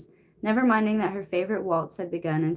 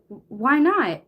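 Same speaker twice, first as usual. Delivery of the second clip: anxious; 6 semitones higher, 5 dB louder than usual. A narrator's reading. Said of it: "in an anxious tone".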